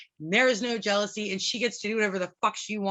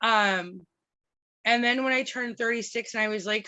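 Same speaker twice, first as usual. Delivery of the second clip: ordinary delivery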